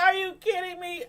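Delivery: a high, half-crying incredulous whine; mock disbelief. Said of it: "I'm like".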